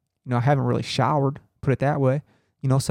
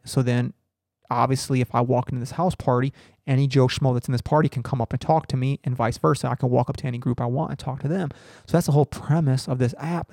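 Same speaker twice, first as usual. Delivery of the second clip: ordinary talk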